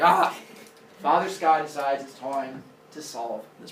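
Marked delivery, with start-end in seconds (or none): 0.0-0.4 high, loud cry of frustration; exasperated yell. Acted. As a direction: burst out in mock exasperation. Father Sky's exasperated cry.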